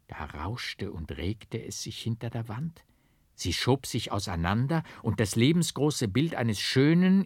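ordinary delivery, nothing marked

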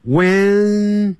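When held long with no d sound; silent D. Shown as a demonstration. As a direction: stretch When, drop the D. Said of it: "那个D不念出来的". (D不发音，拉长When)

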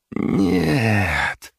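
high, drawn-out gloating "no". A narrator's reading. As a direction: stretch it out with spite. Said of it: "протянула Чейни и злорадно усмехнулась".

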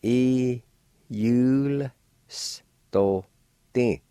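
"E, yul, s, to, ti" each syllable said apart; careful demo syllables. Shown as a demonstration. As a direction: break the word into separate syllables. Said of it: "like this".

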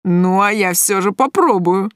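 slow, taunting thug voice; leering guy calling a bluff; cocky defiance. A narrator's reading. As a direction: draw it out as a smug challenge. Thug challenges the shopkeeper's warning.

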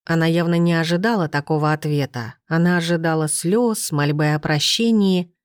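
usual speaking voice

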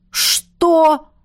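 high, startled question; astonished. A narrator's reading. Roderick hears a shocking suggestion, astonished. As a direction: pitch it up in amazement. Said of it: "изумился Родерик".

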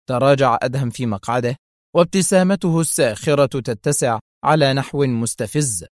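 ordinary delivery, nothing marked

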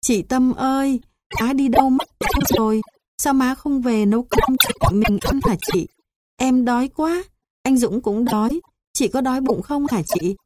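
put-on high, tearful small child's voice, pleading; a hungry little brother asking his sister through tears, pitched high; high-pitched whimpering child begging his sister; crying little boy asking. A narrator's reading. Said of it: "thằng Châu vừa khóc vừa hỏi tôi".

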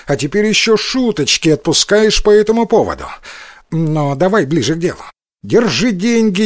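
высокая, напористая речь разбойника; сыграй азартного разбойника, заметно выше и чуть громче; восклицания разбойника. A narrator's reading. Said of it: "воскликнул разбойник". (возбуждённо, на повышенном тоне)

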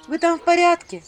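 high loud call into the dark. A narrator's reading. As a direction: shout down into the well. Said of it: "крикнула я в темноту".

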